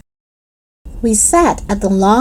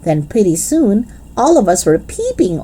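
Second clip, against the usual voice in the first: plain reading voice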